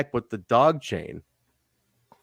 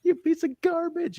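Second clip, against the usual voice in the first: scornful woman. Retold or imitated. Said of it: "she's like".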